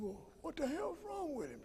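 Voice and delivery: imitating Sterling. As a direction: pitch way up and soft, mimicking the friend. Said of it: "It's the way he talked".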